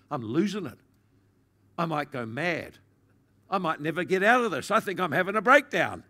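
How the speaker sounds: rising from worried to loud and high, near panic; panicked thoughts. Retold or imitated. Recalling her first breakdown in prayer, panic building loud. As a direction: let the fear of losing your mind build into a cry. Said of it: "you may get a bit frightened by it".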